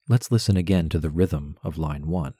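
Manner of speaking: ordinary talk, nothing marked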